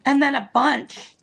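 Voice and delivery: rough, tired hoarse voice. Real, not acted. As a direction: voice going hoarse. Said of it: "I feel like I'm losing my voice".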